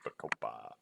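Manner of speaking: low, soft "Bacopa" with an open final "pah"; "Bacopa" dropped low, as buh-koh-pah; relished "pah" ending. Shown as a demonstration. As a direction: savor the last syllable of "Bacopa". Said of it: "I like saying pa".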